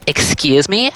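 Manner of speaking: mock affronted, pitch lifting at the end; offended disbelief. Girl taken aback by the word.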